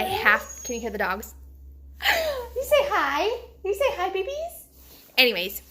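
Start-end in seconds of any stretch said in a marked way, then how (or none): 2.0-2.5 high gasp at the dogs; sharp delighted intake; excited gasp. Real, not acted. Notices the dogs. 2.6-4.7 high sing-song pet voice. Real, not acted. Talking to the dogs.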